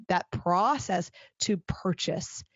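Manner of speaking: ordinary delivery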